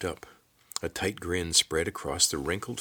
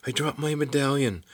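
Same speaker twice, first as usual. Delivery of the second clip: put-on much higher-pitched panicked girl; alarmed cry; 9 semitones higher than usual. A narrator's reading. Kate tells Corvin she lost her medallion, alarmed cry.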